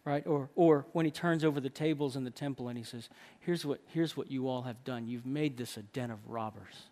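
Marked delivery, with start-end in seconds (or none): 3.4-6.8 low, quiet reproach; stern rebuke. Acted. Jesus to those in the temple, low stern rebuke. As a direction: rebuke them in a lowered voice. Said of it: "There's rebuke".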